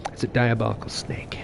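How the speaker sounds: breathy conspiratorial whisper on the punchline; hushed joke aside. Pretend. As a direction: drop to a sly whisper for the reveal.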